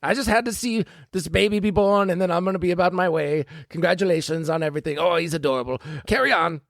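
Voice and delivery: high, fluttery nurse voice, rushing; disguised villain slipping out after the birth; falsetto nurse. Acted. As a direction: gush like an over-sweet fake nurse. Disguised nurse introducing herself.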